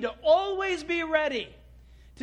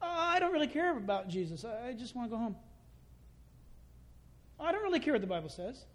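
soft, disinterested mimicry; mock apathy; 6 dB quieter, faster than usual. Bored churchgoer who wants to go home, mock indifferent. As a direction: mumble it like someone who wants out. Voices bored people not drawn to Jesus.

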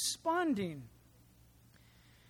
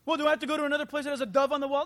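rushed seeker question; 7 dB louder, much faster than usual. Pretend.

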